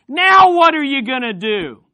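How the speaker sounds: loud, high demand; mock challenge. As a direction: throw down a loud challenge as the crowd. Acted crowd challenging Jesus.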